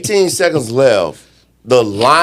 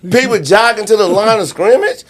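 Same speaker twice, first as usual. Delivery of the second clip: much higher, incredulous pitch; exasperated disbelief; 9 semitones higher than usual. Real, not acted. Analyst arguing a timeout was still available, exasperated. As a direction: plead the obvious in disbelief.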